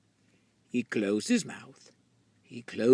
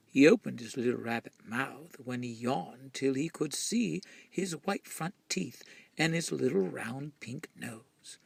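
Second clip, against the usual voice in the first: ordinary delivery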